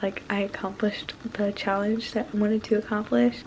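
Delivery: ordinary talk, nothing marked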